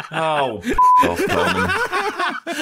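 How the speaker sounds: high, loud laughing yell; laughing shout. Real, not acted. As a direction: shriek it through laughter. Laughing outburst at friend.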